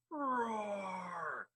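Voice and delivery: long, high playful roar, falling away; lion's roar. Acted. Storyteller becomes the lion for children, playful roar.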